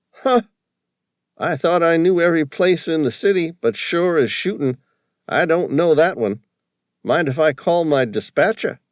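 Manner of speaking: high, loud broad East Coast cabbie voice. A narrator's reading. Cabbie calling to Sam from the taxi, broad East Coast accent. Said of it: "his fake accent".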